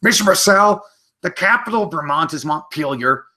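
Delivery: high, bright mock child voice; imitating an eager student reciting facts; know-it-all kid. Teacher voicing an eager student, high mock kid voice.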